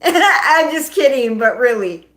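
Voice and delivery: loud laugh running into the words. Real, not acted. Host takes back a crude baseball joke, laughing loudly.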